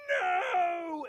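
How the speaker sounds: loud, high, drawn-out wail; hammed-up howl of disbelief; anguished cry. Romeo hears Juliet is dead, melodramatic shout.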